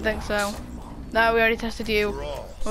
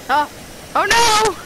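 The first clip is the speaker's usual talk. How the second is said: high dismay; 7 semitones higher than usual. Real, not acted. Player just broke it, high dismayed cry. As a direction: wail it out, pitched high.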